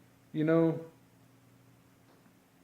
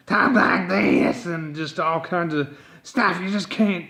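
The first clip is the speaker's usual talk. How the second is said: Krusty the Clown voice; 3 semitones higher, 5 dB louder, slower than usual. Retold or imitated. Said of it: "people talk like Krusty the Clown".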